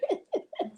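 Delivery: short high-pitched giggle. Real, not acted. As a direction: giggle delighted at the compliment. Laughing at Ella's compliment.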